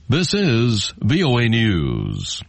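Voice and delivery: slow announcer station ID. Acted. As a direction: announce it like a network ident. Station ID read.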